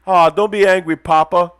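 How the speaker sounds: high, loud mock pleading; teasing son act. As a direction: imitate a son soothing his papa. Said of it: "He look at me".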